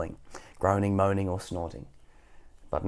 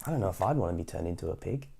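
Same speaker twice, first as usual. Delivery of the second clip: plain reading voice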